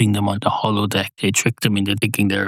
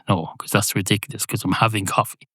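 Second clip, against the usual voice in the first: ordinary delivery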